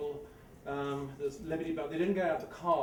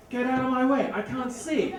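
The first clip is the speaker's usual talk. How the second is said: loud, high snapping at him to move; impatient shout; 7 semitones higher, 8 dB louder than usual. Retold or imitated. Dry cleaning lady shouting at the narrator blocking her view, loud and impatient. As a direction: shove him aside with your voice.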